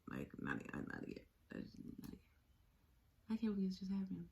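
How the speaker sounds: very quiet, trailing almost into nothing; small, defeated voice, barely audible; hushed despair. Retold or imitated. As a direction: murmur it in numb disbelief. Reliving her despair after the attack.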